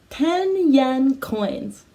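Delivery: ten yen coins stretched out slowly; slow, deliberate ten yen coins; slow model phrase. Shown as a demonstration. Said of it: "Can you say ten yen coins?".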